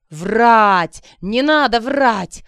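loud, drawn-out angry shout; furious yell, climbing in pitch; enraged yell. A narrator's reading. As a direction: scream the accusation, stretching each word. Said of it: "уже визжал от гнева поджигатель".

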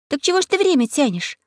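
high indignant reproach; angry girl. Acted. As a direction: scold the crow, sharp and high. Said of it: "рассердилась Алиса".

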